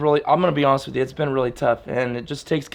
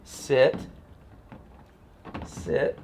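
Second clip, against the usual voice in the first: usual speaking voice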